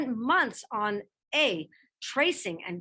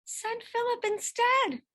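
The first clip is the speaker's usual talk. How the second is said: much higher, pleading protest in a put-on boy's voice; bitter argument; 11 semitones higher than usual. A narrator's reading. Teenage son arguing with his mother, bitter and high. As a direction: push back at mother, aggrieved and bitter. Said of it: "He argued bitterly".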